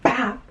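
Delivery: a single loud punched bop; punchy bop. Shown as a demonstration. Showing the viewer how to swing, loud bop. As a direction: put your back into the hit. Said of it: "you have to put your back into it".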